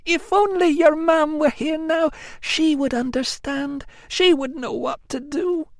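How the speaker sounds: put-on high, plaintive young woman's wail; whiny Amelia. A narrator's reading. Amelia wailing to her cousin, high and plaintive. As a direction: wail it like a spoilt cousin begging pity. Amelia longing for Tilla's mam.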